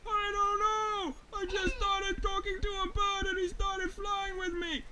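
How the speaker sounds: high, stretched calling voice; bewildered flying bear. Acted. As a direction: explain helplessly from up in the air. Flying bear calls back, distressed.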